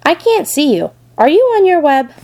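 a touch of anxious searching; worried piglet. A narrator's reading. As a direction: ask upward in a small pig's voice. Piglet cannot see his friend.

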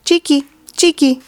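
high, chattering "Cheeky, cheeky"; scolding swallows. A narrator's reading. Swallows scolding whoever enters the barn, high chatter.

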